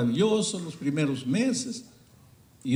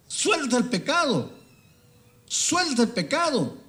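pleading plea; 12 semitones higher than usual. Acted. Preacher pleads with sinners.

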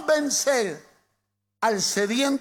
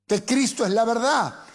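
ordinary talk, nothing marked

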